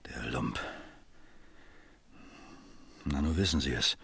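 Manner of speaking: whispered curse under the breath; hushed contempt; bitter whisper. Acted. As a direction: spit it out in a low whisper.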